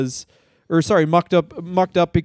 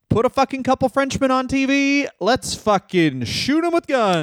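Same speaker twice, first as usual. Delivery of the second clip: pitched well up, lightly hammed patriot; a light mock of 2003 producers demanding Frenchmen on TV; mock jingoist producer; 8 semitones higher than usual. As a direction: play a gung-ho TV exec, slowing into the last line. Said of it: "they were like".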